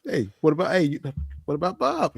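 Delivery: halting movie quote, lifting in pitch; quoted movie character. Acted. Host acts out a What About Bob line, lightly put-on voice. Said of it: "He, he go".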